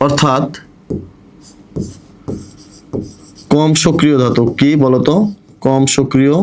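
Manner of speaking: dictation. Acted. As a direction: spell it out so they can write it down. Dictating notes to class. (ধীরে ধীরে জোরে note বলে দেওয়া)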